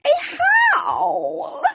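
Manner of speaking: slow, drawn-out dog howl on the words; a yowling hound, hammed up. A narrator's reading. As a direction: let the word howl become a howl. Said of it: "The hound yowled".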